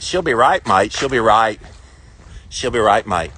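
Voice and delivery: playful put-on Australian accent; Aussie accent. Southerner showing off his beloved Aussie accent. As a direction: favourite party-piece Aussie accent. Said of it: "doing my Aussie accent".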